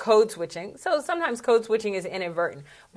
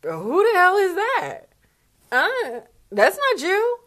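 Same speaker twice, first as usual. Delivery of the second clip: high, slow mock disbelief; incredulous; 10 semitones higher, slower than usual. Watching a speaker friend's video, high incredulous disbelief. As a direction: react as if you can't recognize your friend. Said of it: "I'm like".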